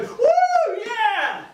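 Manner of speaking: high, drawn-out whoop; imitated whooping cheer. Older lady cheering the comic with his top off, imitated whoop. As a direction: cheer like a thrilled heckler.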